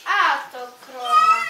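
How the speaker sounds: loud furious cry; a stern seal bellowing; angry shout. A narrator's reading. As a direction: yell it at the cow. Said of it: "Foka gniewna i surowa zawołała".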